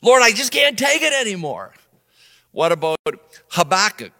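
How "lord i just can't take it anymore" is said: higher, louder exasperated cry; fed-up plea. Retold or imitated. Elijah crying out to the Lord, fed up. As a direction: throw up your hands at God. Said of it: "fed up with what was going on".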